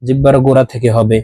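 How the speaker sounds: ordinary talk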